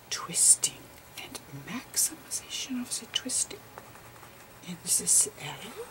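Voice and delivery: soft unvoiced whisper, explaining gently; whispered lecture. Lecturer explaining photon slices to viewers, whispering.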